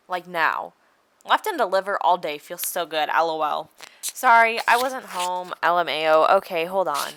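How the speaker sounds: texts read out in voices; reading texts aloud. Shown as a demonstration. Reading the boy's and her texts on screen.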